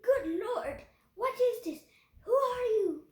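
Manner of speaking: loud, high, startled duke; astonished. A narrator's reading. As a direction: demand answers in shock. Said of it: "he gasped".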